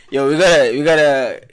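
ordinary delivery

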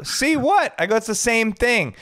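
high, incredulous comeback; mock exasperated retort. DJ to reality star, high mock disbelief. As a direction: throw it back at her in disbelief.

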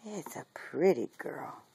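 hushed whisper to a puppy. Real, not acted. Soothing a little puppy, in a gentle whisper. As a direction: breathe it softly, no voice.

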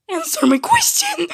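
put-on a small child demanding an answer; child's demand. [name] demanding his parents answer him, high kid voice.

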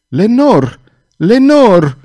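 loud, high, desperate cry; anguished high shout; screamed name. A narrator's reading. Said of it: "țipă el".

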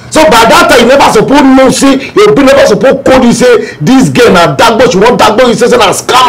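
high, agitated rant; heated scolding. Acted. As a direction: berate a friend for being fooled. Scolding friend for being duped.

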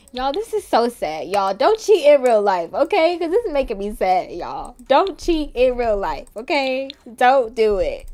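loud, high, impassioned plea; loud pleading. Real, not acted. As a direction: beg them at full volume. Mock-upset plea to viewers.

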